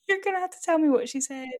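high, quick, laughing imitation; amused mock plea. Retelling a friend baffled by Rosemary's accent, laughing high mock plea. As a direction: repeat it fast and high, through laughter.

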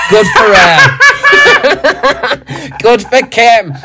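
high, giddy cheer through laughter; laughing cheer. Real, not acted. To his co-host cheering Kim, laughing gleefully. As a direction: crow with delight between laughs.

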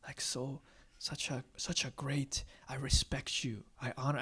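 hushed admiring whisper; awed whisper. Retold or imitated. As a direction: breathe the admiration. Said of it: "hoping that they would think".

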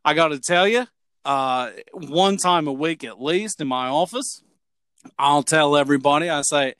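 put-on Australian accent, chatty storytelling; broad amateur Australian dialect. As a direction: play a jovial Aussie explaining the office rule. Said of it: "That's a pretty good accent".

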